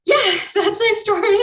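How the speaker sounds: high, loud, laughing through tears; breaking into a teary laugh; emotional laugh-cry. Real, not acted. Speaker apologizing to the host, laughing through tears. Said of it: "I'm sorry I got a little emotional".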